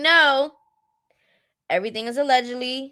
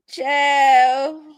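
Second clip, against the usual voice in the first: usual speaking voice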